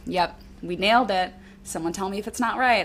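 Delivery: usual speaking voice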